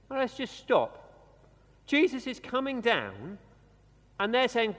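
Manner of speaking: ordinary talk, nothing marked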